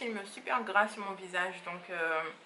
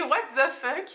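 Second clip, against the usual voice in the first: louder, higher mock-outraged protest; mock indignation; 5 semitones higher, 7 dB louder than usual. Her skin protesting to her about Martinique, mock indignation. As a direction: play-act an affronted complaint, laughing it off.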